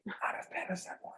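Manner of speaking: very soft whisper; hushed, whispered comeback; whispered aside. Real, not acted. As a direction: say it under your breath. Aside disparaging apartments under breath.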